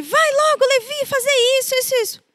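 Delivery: put-on high sharp scolding of a child; scolding mother. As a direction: hurry the boy along, impatient. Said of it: "quando eu brigava com ele".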